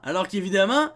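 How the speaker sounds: ordinary delivery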